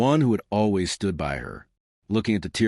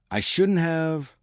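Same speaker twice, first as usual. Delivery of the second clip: put-on tearful girl voice; 7 semitones higher, slower than usual. A narrator's reading. Willow pleading, choked up.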